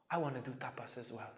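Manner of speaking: quick, soft eager plea; soft request. Retold or imitated. Polly asking his guru for tapas, soft and eager. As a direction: ask him quietly and eagerly.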